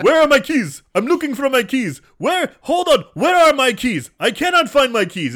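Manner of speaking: high, loud, exaggerated bad acting; hammy over-announcing. Mocking film's obvious dialogue, hammy loud shouting. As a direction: shout every line as blatant exposition. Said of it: "They just straight up tell you".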